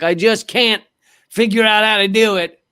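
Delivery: lightly put-on presidential whine of helplessness; George W. Bush. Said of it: "George W. Bush impression".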